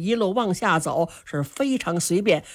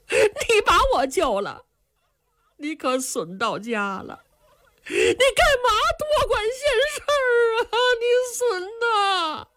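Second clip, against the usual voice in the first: much higher, sobbing reproach of a rescuer; crying young man; 14 semitones higher than usual. Acted. Rescued young man cries and blames his rescuer, sobbing. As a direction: wail at him for saving you. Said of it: "哎呦一声就哭出来了".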